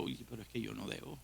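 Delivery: quick hushed aside to oneself; inner self-reminder. Pretend. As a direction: mutter it to yourself quickly.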